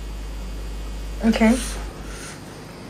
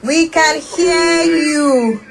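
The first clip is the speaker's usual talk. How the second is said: slow, raised, spelled-out words; to someone hard of hearing; 5 semitones higher, much slower than usual. Real, not acted. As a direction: stretch each word for a bad line. Caller still can't hear.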